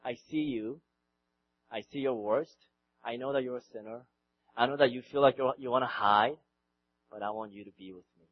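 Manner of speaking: low, soft, intimate reassurance; Jesus tender. Retold or imitated. Jesus tenderly calls Peter close, low and soft. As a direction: speak softly as if to someone afraid. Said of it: "Jesus is saying".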